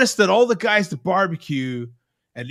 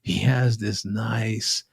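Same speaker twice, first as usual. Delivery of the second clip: low, slow, subtle Texas accent; slow Texas drawl; 7 semitones lower, 4 dB quieter, slower than usual. Shown as a demonstration. Host describing his Texas pit builder, slow Texas drawl. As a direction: stretch it out like a slow Texan. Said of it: "It's a real slow, subtle accent".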